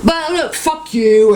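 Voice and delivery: very high, squeaky mock voice; high mocking imitation of the other man; mocking the other one. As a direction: parody a whiny stranger. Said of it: "another one went".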